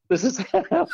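high laugh through the words. Real, not acted. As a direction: laugh as you say it. Laughing at himself.

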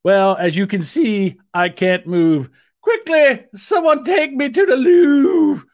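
royal voice climbing to a loud falsetto; hammed-up Queen. Queen who can't move calling for help, in mock British falsetto rising to a wail. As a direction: start stately, then cry out high for help. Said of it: "she pointed to her left leg and said".

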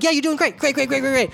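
quick, high-pitched yes-man gushing; yes-man flattery. Acted. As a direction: pile on praise like a fawning underling. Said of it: "people who probably told him, like".

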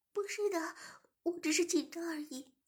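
high, breathy-free pleading plea; pleading submissive voice. Acted. Zhimin begs the shop owner and master to stop, high pleading voice.

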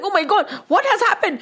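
high, alarmed exclamation; scared. Acted. Rana reels at the miracle, scared. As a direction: cry out in acted panic. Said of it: "He, he was scared".

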